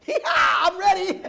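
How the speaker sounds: loud, high, drawn-out cowboy-style yell; eager whoop. Retold or imitated. Drummer jumping at seven hundred dollars, whooping eagerly. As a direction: whoop it out slowly with a laugh in it.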